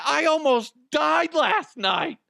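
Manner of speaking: high, loud, drawn-out protest; retold outrage. Clerk to boss after being threatened, high loud outrage. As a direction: wail it in indignant disbelief.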